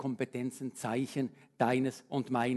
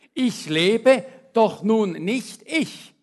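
loud, slow, higher proclamation of the verse; proclaiming scripture; 8 semitones higher, 12 dB louder, much slower than usual. Acted. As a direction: declare it to the congregation. (feierlich verkündet, laut und langsam)

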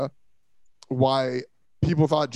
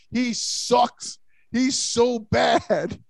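higher, dragged-out shout of disgust; exasperated shout; 7 semitones higher, much slower than usual. Real, not acted. Ranting to friends that Hurts is bad, exasperated shout. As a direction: stretch it out, fed up.

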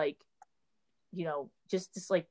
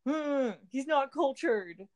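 higher, drawn-out mock snobbery; mock disapproval; 6 semitones higher, much slower than usual. Host parodying friends judging the actor, mock disapproval. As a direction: imitate friends' disdain, slowly and higher. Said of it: "you guys are like".

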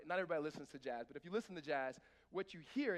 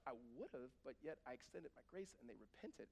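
softer, lower gentle reply; gentle God; 5 semitones lower, 9 dB quieter than usual. Pretend. God gently answering angry Jonah. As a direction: answer calmly, quietly. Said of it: "God's like".

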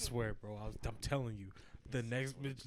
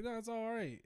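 mock gracious; 10 semitones higher than usual. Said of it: "he's like".

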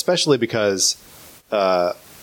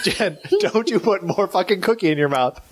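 laughing; 8 semitones higher than usual. Real, not acted. Laughing mock scolding.

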